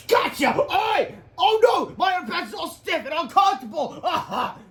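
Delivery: high, loud shouting; hammed-up cartoon yells. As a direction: cry out like a beaten hero. Comic fight shouts.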